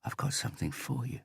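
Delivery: soft unvoiced whisper. A narrator's reading. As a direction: keep whispering it close. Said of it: "he whispered".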